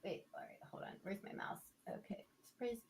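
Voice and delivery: barely voiced whisper; muttering to self. Real, not acted. Host hunting for the computer mouse, muttering quietly. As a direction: whisper it as a private aside.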